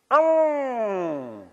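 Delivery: put-on the mother's one-word warning to the kids; mother's ominous oh. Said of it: "She said".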